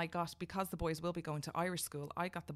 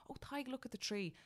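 higher, gentle granny voice; grandmother voice; 8 semitones higher, 4 dB quieter than usual. Retold or imitated. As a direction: coax a toddler kindly. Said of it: "she'd say".

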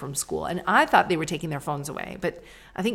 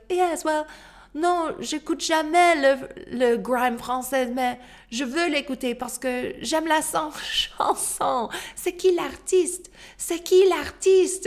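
high, louder, slow showy French; eager mom in French; 10 semitones higher, 5 dB louder, slower than usual. Retold or imitated. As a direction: show off to the kids, slowing on qui.

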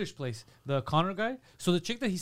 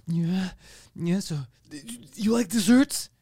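halting, slow imitation, louder at the end; big sword guy; 2 semitones higher, 4 dB louder, slower than usual. Big guy asking his date about desserts, halting mock awkwardness. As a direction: stumble into the question, then push it out loud. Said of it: "the big guy with his, he'll be like".

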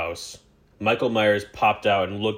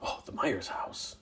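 hushed whisper; 13 dB quieter than usual. Real, not acted. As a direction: whisper it in suspense. Spotting the Myers house.

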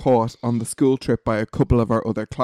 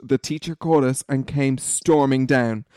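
normal talking voice

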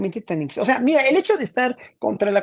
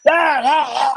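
loud, hammed-up yells, higher in pitch; imitated yelling; 6 semitones higher, 7 dB louder, slower than usual. Guest mimics Madero shouting in the Senate, loud mock yells. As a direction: bellow like a senator on the floor. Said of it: "cómo gritaba Madero".

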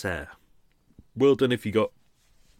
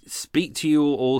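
ordinary talk, nothing marked

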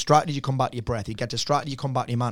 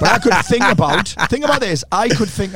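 building excitement; 6 semitones higher, 5 dB louder than usual. Real, not acted. Building up to a joke.

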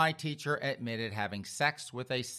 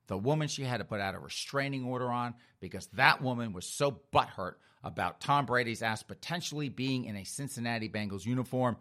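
ordinary talk

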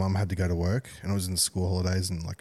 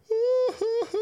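very high imitated kid's howl; hammed-up high-pitched bawling; mock child wail; 3 dB louder than usual. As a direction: imitate a little boy collapsing into wails. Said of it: "dropped on the floor and he's just".

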